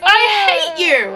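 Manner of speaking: slow, high drawn-out I hate you; bratty teen. Acted. Reader voices Patricia sulking at her mom. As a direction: sulk it out like a wronged teenager. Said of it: "So Patricia says".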